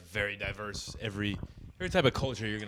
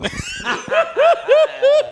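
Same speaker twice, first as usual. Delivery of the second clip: loud high-pitched laugh; shrieking laugh; 18 dB louder than usual. Real, not acted.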